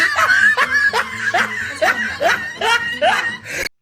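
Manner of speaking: big real laugh, loud and high; loud burst of laughter. Real, not acted. Telling friends about the laptop, a loud real laugh. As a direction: howl with laughter at your own bad luck.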